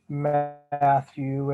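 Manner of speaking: ordinary talk, nothing marked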